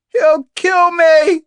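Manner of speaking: high, drawn-out, slow yell; Kate McTiernan shouting. Acted. Captive answering the danger from behind her door, shouting. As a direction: shout it loud and stretch it out. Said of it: "shouted Kate".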